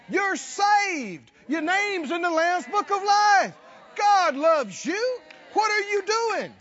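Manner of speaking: loud, high pep talk to yourself; shouted self-talk. Pretend. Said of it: "and say".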